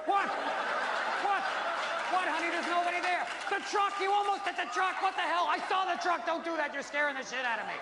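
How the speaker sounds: put-on high, panicky nagging girlfriend. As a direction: squeal at him like a scared passenger. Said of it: "making this noise".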